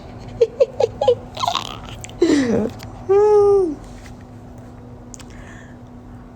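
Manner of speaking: airy embarrassed laughing fit; long high breathy giggle. Real, not acted. Cringing at her own line. (mahabang mataas na hagikhik)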